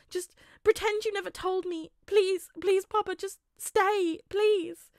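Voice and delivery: put-on high, loud, tearful begging child; terrified pleading. A narrator's reading. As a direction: plead as if he'll vanish. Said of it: "like he's terrified".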